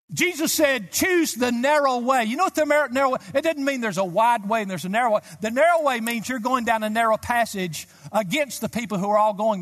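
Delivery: full-voiced sermon shout; preaching shout. Real, not acted. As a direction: call it out to the congregation.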